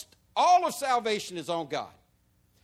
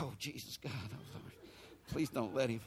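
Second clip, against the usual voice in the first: hushed, much softer worried prayer; fearful pleading; 4 semitones lower, 10 dB quieter than usual. Retold or imitated. Sister pleads softly that her brother stay calm. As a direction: breathe it out as a frightened plea.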